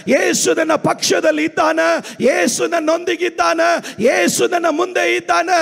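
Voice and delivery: rousing, high-pitched sermon delivery; full-force preaching. Real, not acted. Pastor declaring Jesus is on his side, full-force preaching. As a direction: declare it with mounting conviction.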